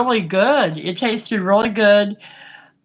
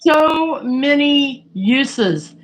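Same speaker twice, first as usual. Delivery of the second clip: normal talking voice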